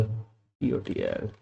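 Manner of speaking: letters spaced far apart for typing; soft, drawn-out dictation; very slow spelling. Shown as a demonstration. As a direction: spell it out slowly and softly. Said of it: "monthly total".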